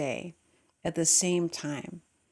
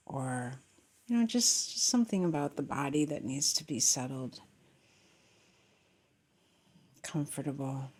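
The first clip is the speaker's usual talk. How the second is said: guided meditation; 3 semitones lower than usual. Real, not acted. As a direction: gently settle them into their breath, lowering the voice.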